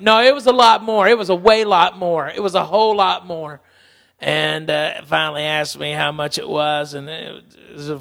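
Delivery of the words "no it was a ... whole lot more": high, loud, emphatic; insistent correction. Retold or imitated. Wife loudly correcting how much he smoked. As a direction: correct him forcefully. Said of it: "Lana was like".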